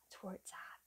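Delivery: hushed aside to viewers; very soft, unvoiced-sounding whisper; confidential whisper. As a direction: whisper it like a secret tip. Confidential aside to women watching.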